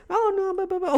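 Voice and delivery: very high-pitched dismissive babble; singsong mock brush-off; mocking imitation. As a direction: parody someone dodging the question. Mocks patient's evasive answers.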